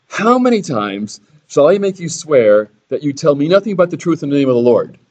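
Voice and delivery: king needling Micaiah, sarcastic. Acted. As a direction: roll your eyes at the prophet. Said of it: "Which is also sarcasm".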